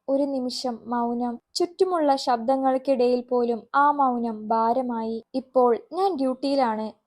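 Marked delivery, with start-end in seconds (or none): none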